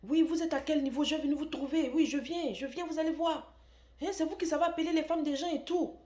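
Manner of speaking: high, loud mocking threat, a laugh slipping in; imitating furious husband. Jealous husband calls the brother back, loud mocking threats. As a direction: shout down the line, half laughing at him. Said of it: "Et il commence à crier".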